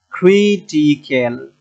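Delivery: Critical stretched out, ক্রি leaned on; syllable-by-syllable critical with weight on ক্রি; stress on ক্রি. Shown as a demonstration. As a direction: draw out ক্রি at the start of critical. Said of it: "ক্রি, তিন নাম্বারে এসে আমাদের".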